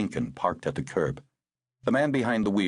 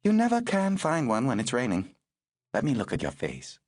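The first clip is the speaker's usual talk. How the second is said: higher, crisp voice for the woman; brisk older woman; 6 semitones higher than usual. Acted. Woman tending the girl in the rain, brisk and higher. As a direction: speak as the no-nonsense rescuer.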